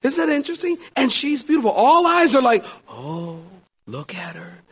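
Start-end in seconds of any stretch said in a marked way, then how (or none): 2.9-4.6 very soft awestruck murmur; hushed admiration. Retold or imitated. Wedding guests gazing at the bride, hushed admiration. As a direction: admire her under your breath.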